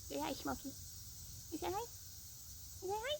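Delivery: high cooing voice, climbing with each ask; pet talk. Real, not acted. Asking the cat to say hi to viewers, high pet talk. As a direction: coax a pet in a high little voice. Coaxing pet to greet camera.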